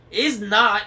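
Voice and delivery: high, loud hag's yell. A narrator's reading. Anger yelling at her sister. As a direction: yell it back like a shrieking witch. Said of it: "yelled the one called Anger".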